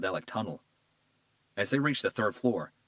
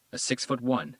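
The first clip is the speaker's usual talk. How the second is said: ordinary delivery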